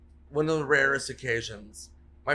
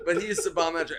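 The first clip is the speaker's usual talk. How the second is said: ordinary talk, nothing marked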